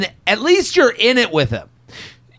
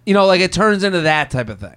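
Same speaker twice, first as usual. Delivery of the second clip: ordinary talk, nothing marked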